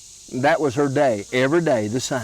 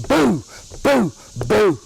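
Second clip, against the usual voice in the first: hollered boo; 7 semitones higher, slower than usual. Retold or imitated. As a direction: holler it three times to scare her. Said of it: "jumped out and hollered".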